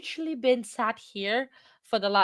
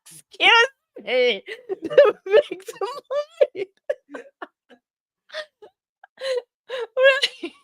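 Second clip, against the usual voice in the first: laughing in disbelief; 13 semitones higher, 6 dB louder than usual. Real, not acted. Reacting to claim victim lied.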